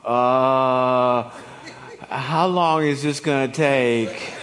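drawn-out, slow groan of dread; reluctant husband. Acted. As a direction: groan it out like a put-upon husband. Acts out husband dreading a talk.

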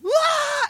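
high, loud mock yelp of pain; sudden wail, imitating someone hurt; imitated pain shout. Retelling a partner's knee injury on the mat, imitated pain yelp. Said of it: "she was just shouting in pain".

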